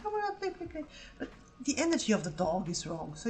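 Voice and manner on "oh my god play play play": much higher, gushing dog voice; excited puppy voice. Retold or imitated. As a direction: squeal like an overexcited dog. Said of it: "so she's like".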